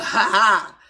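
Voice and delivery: a short loud laugh, ha ha; real laugh. Real, not acted. Cook laughing at her own joke to the viewers, loud laughter.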